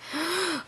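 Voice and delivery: sharp mock gasp of amazement; quick high gasp. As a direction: gasp like you just did something bold. Shocked at her own courage.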